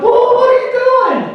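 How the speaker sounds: acted high-pitched alarm; aghast. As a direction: wail it in comic disbelief. Son aghast at marked underwear.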